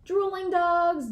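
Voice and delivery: ordinary delivery, nothing marked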